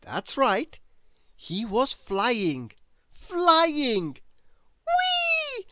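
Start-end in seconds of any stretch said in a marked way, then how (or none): 3.1-4.2 higher, lifted exclaim; delighted. A narrator's reading. Narrator marvels. 4.9-5.7 very high gleeful cry; joyful whee. A narrator's reading. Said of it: "he cried".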